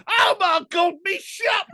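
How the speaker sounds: very high squeaky Cockney alien; London accent Watto. Retold or imitated. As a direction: wail it like a panicked shopkeeper. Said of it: "Watu with a London accent".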